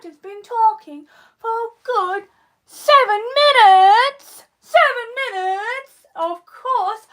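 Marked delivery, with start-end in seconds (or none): 2.7-4.4 slow, loud and emphatic; loud, drawn-out announcement; loud exclamation. Real, not acted. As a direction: punch out the time, stretching each word. Exclaims streaming time, then repeats.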